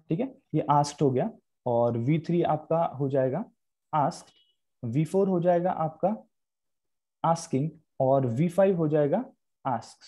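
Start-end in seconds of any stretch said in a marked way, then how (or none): none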